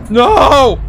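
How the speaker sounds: high, loud cry of fright; scared yell. Real, not acted. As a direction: shout in panic. Jump scare hits.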